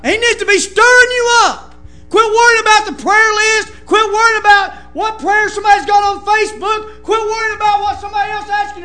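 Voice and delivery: loud, rising pulpit exhortation; preaching at full force. Acted. Preacher rebuking the backslidden, shouting. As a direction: hammer it home to the church.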